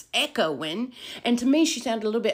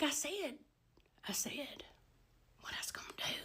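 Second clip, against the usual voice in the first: very soft, broken into pauses; hushed reply; 5 semitones higher, 17 dB quieter than usual. Retold or imitated. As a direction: whisper it in halting pieces. Acts out breathless, hushed reply.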